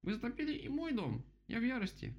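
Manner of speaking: very high-pitched furious little vole; thin squeak. A narrator's reading. Vole's house is flooded too, thin squeak. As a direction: rage in a tiny thin voice. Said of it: "ещё более тонким голоском".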